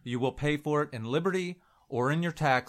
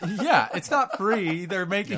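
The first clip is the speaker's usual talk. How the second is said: laughing agreement; 5 semitones higher, 4 dB louder than usual. Real, not acted.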